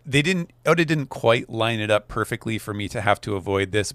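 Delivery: usual speaking voice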